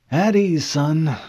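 put-on high, kindly old admiral. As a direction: wave him down like a grandfather officer.

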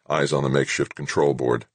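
usual speaking voice

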